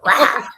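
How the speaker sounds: loud, sudden "Rah!"; startling roar. Retold or imitated. Mom sneaking up behind her to scare her, startling roar. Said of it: "I went".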